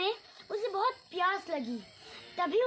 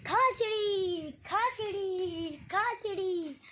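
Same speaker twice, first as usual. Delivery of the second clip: slow drawn-out chant; calling out slowly; much slower than usual. Retold or imitated. Sarju calling to the birds, slow drawn-out chant. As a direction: sing it out slow and dumb. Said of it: "चिल्लाते हुए बोल रहा है".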